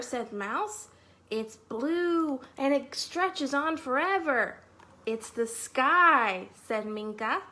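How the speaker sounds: animated, slow storytime read-aloud; lively storytime narration for preschoolers; reading to children. Acted. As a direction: read it like a picture book to little kids. Reading picture book to children.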